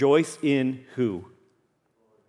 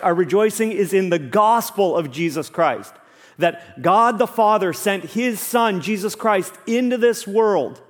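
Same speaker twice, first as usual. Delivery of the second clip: raised-pitch, emphatic gospel proclamation; impassioned preaching; 5 semitones higher than usual. Real, not acted. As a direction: preach it with mounting fervor, punching key words. Preaches the gospel with rising force.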